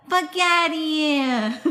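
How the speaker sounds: high, long-drawn New Jersey emphasis; New Jersey accent. Acted. Reading today's mantra to friends, in an emphatic New Jersey accent. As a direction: lean hard on the New Jersey accent, slowly. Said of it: "Say it in a New Jersey accent to add emphasis".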